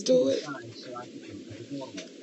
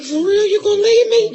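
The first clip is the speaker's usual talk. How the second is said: mock whiny; 9 semitones higher, 7 dB louder than usual. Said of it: "And I be like".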